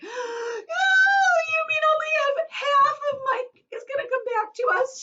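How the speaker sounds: very high, loud, tearful cry; mother horrified only half will return. Acted. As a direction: cry it out in horror.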